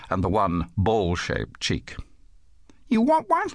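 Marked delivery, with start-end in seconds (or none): 2.9-3.5 put-on high little kid's voice, offering; small child. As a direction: ask in a very high child's pitch. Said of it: "The child dropped back".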